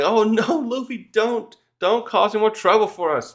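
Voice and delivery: crew wailing at Luffy to stop; alarmed plea. Retold or imitated.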